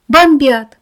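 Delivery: panicked loud cry; shout. A narrator's reading. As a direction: yell the air raid warning. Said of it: "кричал кто-то на улице".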